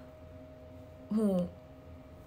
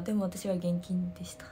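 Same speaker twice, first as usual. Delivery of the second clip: whispered confession; 5 semitones lower, 7 dB quieter than usual. Real, not acted. Sheepish aside to viewers.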